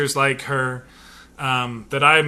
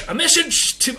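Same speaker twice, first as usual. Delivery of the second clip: a fumbled Russian accent, pitched much higher; attempted Russian accent; 11 semitones higher than usual. Shown as a demonstration. Host trying a Russian accent on the title, half-hearted. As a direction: start the title in a Russian accent, then give up. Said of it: "what is my Russian accent?".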